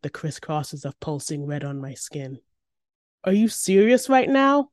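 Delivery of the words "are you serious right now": high, loud incredulous yell; raised voice, outraged question; indignant yell. A narrator's reading. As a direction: yell it at her in disbelief. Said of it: "I yell".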